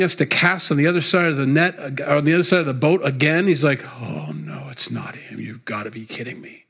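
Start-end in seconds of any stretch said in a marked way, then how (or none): 4.0-6.6 dismayed mutter. Acted. Peter on the boat, muttering in dismay at seeing Jesus. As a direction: lower and softer, a groaning mutter to himself.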